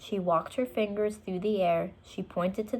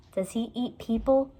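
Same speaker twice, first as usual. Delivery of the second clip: usual speaking voice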